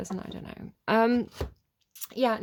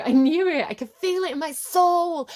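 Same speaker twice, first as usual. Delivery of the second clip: put-on loud, high old blues American accent, belted out; 8 semitones higher, 8 dB louder than usual. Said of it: "doing a little bit of accents".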